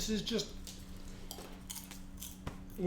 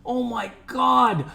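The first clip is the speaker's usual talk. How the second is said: excited; 5 semitones higher, 4 dB louder than usual. Real, not acted. Gushing over multi-GPU training.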